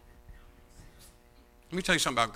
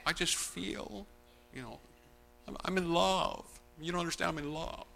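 mock lovesick whine; 4 semitones higher, 7 dB quieter than usual. Imitating lovesick congregant.

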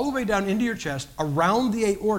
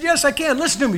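louder, higher, insisting; raised triumphant voice; insistent; 8 semitones higher, 6 dB louder, faster than usual. Retold or imitated. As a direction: show her the voice is back. Proving to wife he can talk.

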